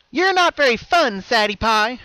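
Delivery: a bubbly voice losing patience; high, loud sing-song villain Pinkie; impatient other Pinkie. A narrator's reading. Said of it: "her patience was wearing thin".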